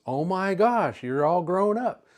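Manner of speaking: bright surprised old teacher, lightly voiced; retold delighted surprise.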